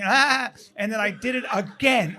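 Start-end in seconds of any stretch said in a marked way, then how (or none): 0.0-0.5 quick high "Ah" to startle; scare yelp. Retold or imitated. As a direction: imitate a sudden scare cry. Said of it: "I fucking scared her again".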